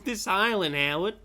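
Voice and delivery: high, loud parody of a film line; mocking mimicry. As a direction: echo the dialogue loudly, making fun of it. Echoing the played clip, laughing.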